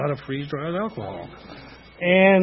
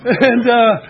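spoken through laughter; laughing; 7 semitones higher, 6 dB louder than usual. Real, not acted. Tim laughs telling how he chose to get high. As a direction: crack up at the punchline. Laughing at his own retort.

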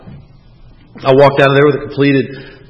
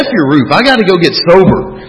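defiant outburst; 5 semitones higher, 6 dB louder than usual. Retold or imitated. Retold quarrel with roofing partner.

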